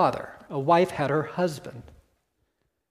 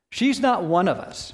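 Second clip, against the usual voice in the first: usual speaking voice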